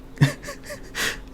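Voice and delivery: high breathless wheeze; wheezing laugh. Real, not acted.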